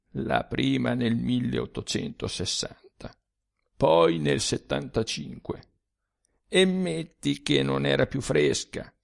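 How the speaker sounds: a weary ghost sharing bitter secrets; ghostly old man. A narrator's reading. Dead Sturzi confides to the young man, low and soft.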